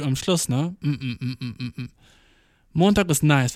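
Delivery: normal talking voice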